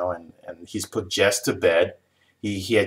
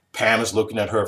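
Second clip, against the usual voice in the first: ordinary talk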